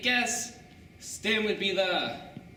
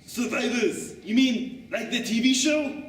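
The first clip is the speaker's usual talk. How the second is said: higher, puzzled tough-guy voice; confused Axe; 4 semitones higher, 3 dB louder, slower than usual. Acted. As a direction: Axe totally missing the point. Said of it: "Axe looked totally confused".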